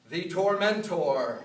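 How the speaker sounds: slow, loud Tormentor; dramatic title. Acted. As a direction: announce the Tormentor grandly. Dramatic nickname for brother.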